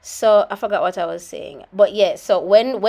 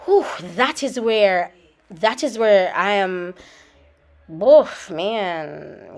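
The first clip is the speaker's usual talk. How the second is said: ordinary talk, nothing marked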